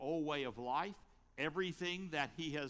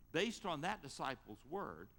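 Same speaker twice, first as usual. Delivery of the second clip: ordinary talk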